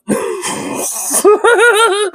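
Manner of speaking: high wheezing laugh; cracking up. Real, not acted. Streamer amused by his own translation, cracking up. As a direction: break into a high, breathless laugh.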